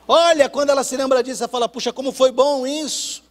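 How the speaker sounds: loud, high, rousing sermon cry; rally them to grab the plank and row; preaching at full force. Acted. Preacher rousing the church to hold on to life, loud.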